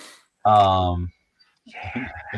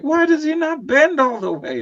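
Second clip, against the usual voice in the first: much higher, louder confused question; puzzled frustration; 6 dB louder than usual. Retold or imitated. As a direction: sound baffled at the toy. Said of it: "I was like".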